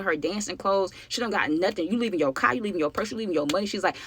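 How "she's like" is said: ordinary talk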